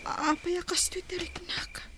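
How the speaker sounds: soft unvoiced whisper; lying in bed whispering her worry; whispered to herself. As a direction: keep it secret, barely breathe it. Muttering alone at night.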